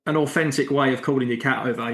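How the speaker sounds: usual speaking voice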